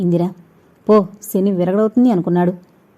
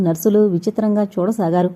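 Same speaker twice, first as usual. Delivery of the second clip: ordinary delivery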